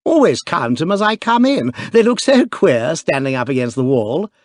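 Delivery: put-on young duke, eager; eager cry. A narrator's reading. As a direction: blurt it out boyishly. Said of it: "cried the young duke".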